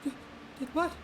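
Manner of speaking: put-on high, startled little girl's voice; frightened girl. Girl woken in the night, high and alarmed.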